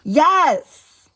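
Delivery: high loud insistent yes. A narrator's reading. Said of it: "Skylar insisted".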